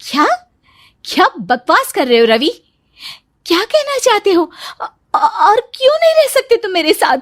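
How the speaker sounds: stunned and indignant, pushing back hard; shocked outrage. Acted. Wife told her husband is leaving, shocked and indignant.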